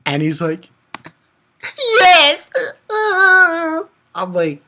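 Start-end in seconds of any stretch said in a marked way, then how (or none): 2.0-2.5 overjoyed yes. Acted. Alibaba answers friends, overjoyed. 2.5-3.8 mock sob laugh. Voicing Alibaba crying happily.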